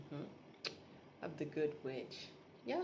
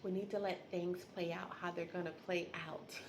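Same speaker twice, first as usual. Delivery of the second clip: much softer, unhurried calm; a calm wife easing her husband's worry; calm reassurance; 6 dB quieter than usual. Retold or imitated. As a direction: soothe him, let it play out. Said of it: "she's so calm too".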